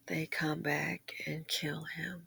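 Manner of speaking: slow, hushed, barely voiced; slow grim whisper. Real, not acted. Vlogger retelling the shop owner's murder, a slow whisper. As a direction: whisper it slowly, weighed down.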